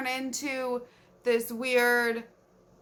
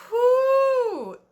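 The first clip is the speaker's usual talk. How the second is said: loud high exasperated whew; 12 semitones higher, 10 dB louder than usual. Real, not acted. Reacting to the awful book.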